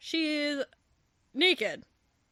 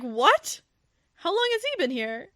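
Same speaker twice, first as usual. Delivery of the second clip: mock-startled, much higher and louder; shocked; 6 semitones higher, 4 dB louder than usual. Matthew hearing a girl sleeps in his bed, startled and high. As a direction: jump up high in disbelief.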